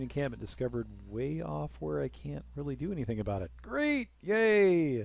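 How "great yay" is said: high, loud mock cheer; sarcastic cheer. Gamer mocking the barbarian discovery with fake cheer. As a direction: fake delight at bad news.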